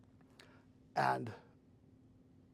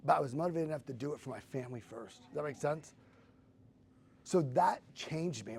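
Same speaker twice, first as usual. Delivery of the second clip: ordinary delivery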